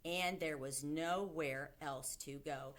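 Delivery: ordinary delivery, nothing marked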